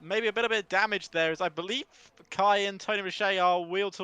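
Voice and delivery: lively play-by-play race call; race commentary. Acted. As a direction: call the race live, building excitement.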